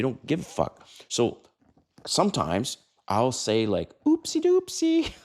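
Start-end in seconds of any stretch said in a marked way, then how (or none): 4.0-5.1 very high, slow, loud Teletubby squeak; baby voice. Shown as a demonstration. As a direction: coo it like a toddler's TV character, drawn out. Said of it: "I don't like your baby voices".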